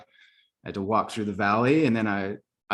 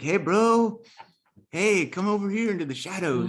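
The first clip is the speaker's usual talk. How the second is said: criminal-esque voice; 10 semitones higher than usual. Retold or imitated. His heart calling him into the shadows, hammed-up hustler voice. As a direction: beckon him into the alley like a hustler. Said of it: "a weird criminal-esque accent".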